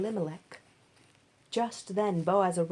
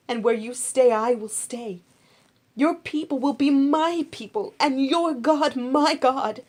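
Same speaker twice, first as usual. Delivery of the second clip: Ruth's fervent vow; 5 semitones higher, 11 dB louder than usual. Acted. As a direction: swear it with your whole heart, rising to a cry.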